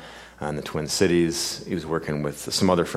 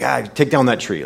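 put-on Brooklyn accent; 5 semitones higher, 4 dB louder than usual. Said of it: "come out with this great Brooklyn accent".

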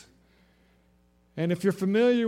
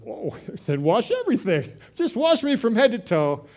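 eager overreaction; 4 semitones higher, 7 dB louder than usual. Retold or imitated. Peter eager to be washed.

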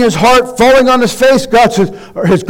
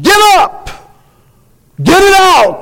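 God commanding loudly; 9 semitones higher than usual. Acted. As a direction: shout it as God ordering a cleanup. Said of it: "God is".

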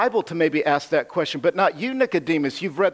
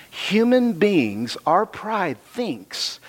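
slow emphatic; much slower than usual. Acted. Emphasis announced on line 62.

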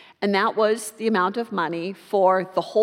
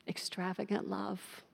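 much softer, hushed and sincere; tender close; 14 dB quieter than usual. Real, not acted. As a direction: let the theme land gently. Sermon closing, theme repeated softly.

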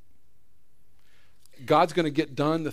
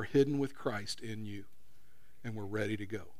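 soft, lower closing prayer; 3 semitones lower, 6 dB quieter than usual. Real, not acted. Pastor praying over the congregation, soft and low. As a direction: pray quietly and humbly.

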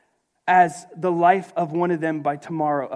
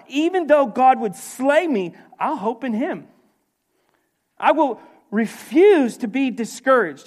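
high, loud declaration of faith; fervent preaching; 7 semitones higher, 6 dB louder than usual. Real, not acted. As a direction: proclaim it with defiant resolve. Preaches Job's defiant declaration.

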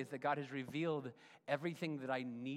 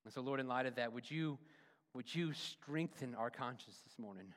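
earnest closing prayer voice; pastoral prayer; 4 dB louder than usual. Acted. Pastor praying over the congregation, earnest. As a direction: pray it earnestly for the church.

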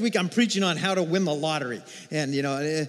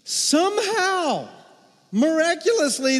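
amazed drawn-out somehow; 11 semitones higher, 5 dB louder than usual. Real, not acted. Marvels at the miracle flight.

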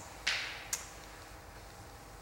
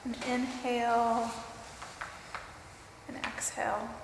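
guided meditation; 3 dB quieter than usual. Acted.

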